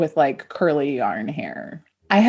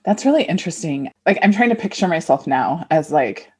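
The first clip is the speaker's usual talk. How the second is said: ordinary talk, nothing marked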